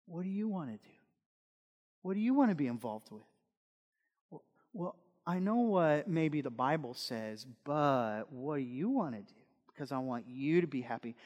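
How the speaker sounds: gently ask, careful not to upset; soft, placating parent. Acted. Parent surveying the kids to please them, soft and placating.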